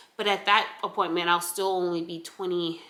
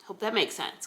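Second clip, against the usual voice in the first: ordinary talk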